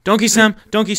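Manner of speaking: high, loud exclamation; excited shout. Real, not acted. Player pointing out donkeys to Sam, excited shout. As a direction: shout to get his attention.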